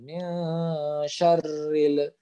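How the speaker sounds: very slow syarril with lam held, not bounced; slow recitation. Shown as a demonstration. Teacher reciting, lam not bounced. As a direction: chant mil syarril slowly. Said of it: "jangan le gitu".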